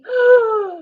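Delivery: sharp high gasp of fright; frightened gasp. Acted. Boy gasping at the closet, a high startled gasp. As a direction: catch your breath in fright.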